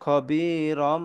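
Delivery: খবিরম read very slowly, the nasal ম held long; খবিরম drawn out at a very slow pace with a held nasal ম; iqlab reading. Shown as a demonstration. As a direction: stretch খবিরম out slowly, ending on a long humming ম. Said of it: "তাহলে এখানে আমরা পড়বো".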